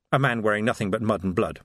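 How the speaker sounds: ordinary delivery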